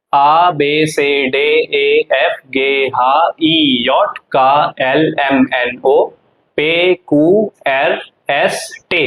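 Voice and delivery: very slow, letter by letter; alphabet recitation. Shown as a demonstration. Teacher starting the alphabet over, slow spaced recitation. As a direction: recite each German letter separately, drawn out. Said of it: "तो start करते दोबारा से".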